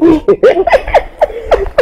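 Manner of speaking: laugh. Acted. Man laughs at his boast.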